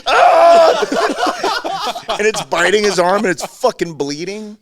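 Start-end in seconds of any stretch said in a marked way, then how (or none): 0.1-2.0 put-on sudden high yelled ah of a kid in fright; mock scream. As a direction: let out a startled child's scream. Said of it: "my brother just like".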